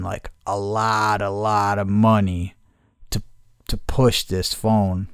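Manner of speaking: slow, hesitant confession; slow reluctant admission. Retold or imitated. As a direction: drag it out slowly, like a salesman caught out. Salesman admits Google paid Verizon.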